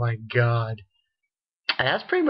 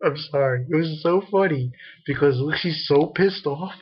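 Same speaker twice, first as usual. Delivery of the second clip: usual speaking voice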